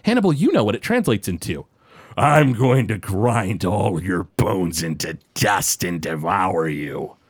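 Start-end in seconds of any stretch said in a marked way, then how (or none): 2.2-7.2 low, slow monstrous growl. Acted. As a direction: growl it out like an abyssal horror. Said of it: "sounds like backwards talk".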